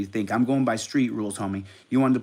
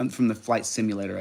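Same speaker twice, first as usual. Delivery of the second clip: ordinary talk, nothing marked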